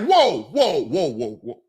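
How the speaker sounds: mock startled. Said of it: "You're gonna be like".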